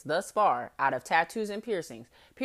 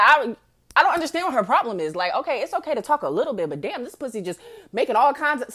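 normal talking voice

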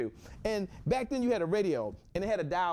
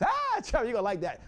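high, loud delighted call to a friend; high excited call to Trevor; 6 semitones higher, 5 dB louder than usual. Real, not acted. Calls out to Trevor.

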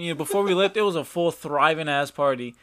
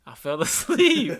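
laughing through it; 7 semitones higher than usual. Retold or imitated. Retelling the dad's line, cracking up.

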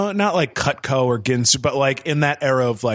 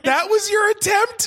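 high incredulous squeal of disbelief. Real, not acted. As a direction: pitch leaps up in disbelief. Teasing disbelief at friend's joke.

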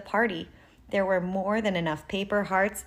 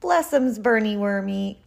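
usual speaking voice

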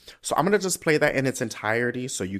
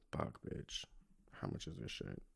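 whispered curse; 10 semitones lower, 20 dB quieter than usual. Real, not acted. Frustrated aside while calculating.

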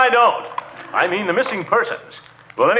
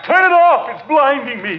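higher, louder snap to turn it off; blinded uncle shouting; annoyed shout; 9 semitones higher, 4 dB louder than usual. Acted. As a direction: shout against the glare. Light blinds him.

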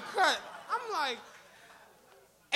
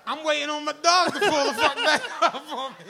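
laughing; 2 semitones higher, 9 dB louder than usual. Real, not acted. Laughing at being left.

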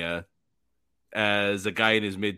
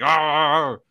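put-on louder, higher grumbling old-timer growls; grumpy boomer grunt; 4 semitones higher, 5 dB louder, much slower than usual. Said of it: "you sound like a boomer".

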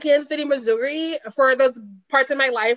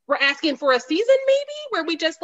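ordinary talk

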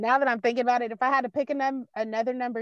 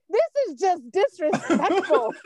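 outraged laugh; 7 semitones higher, slower than usual. Retold or imitated. Retelling outrage at reveal.

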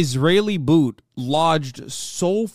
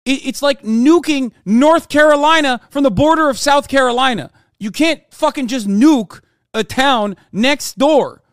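heated rant; 7 semitones higher, 6 dB louder than usual. Real, not acted. Ranting about nuking Gaza.

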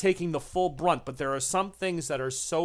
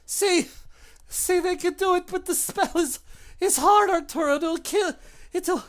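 very high and loud, pained and rushed; shrill desperate creature; 4 dB louder than usual. Acted. The burnt creature desperately explains to Arturo, high and loud. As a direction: gasp it out after the torture.

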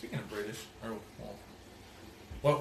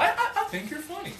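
much higher, laughing, teasing voice; mock high voice; 3 dB louder than usual. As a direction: squeak it out in a laughing tease. Teasing friend's compliment.

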